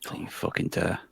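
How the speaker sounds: hissed whisper, mock menace; whispered threat. Whispering a threat as the nominees are read, hushed. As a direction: breathe it as a mock warning.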